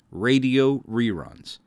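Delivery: normal talking voice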